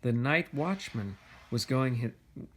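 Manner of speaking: ordinary delivery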